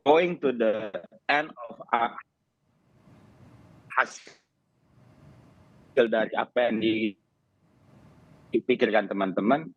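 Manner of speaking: choppy broken connection; radio breaking up. Real, not acted. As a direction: voice cutting in and out. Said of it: "Suara Anda terputus?".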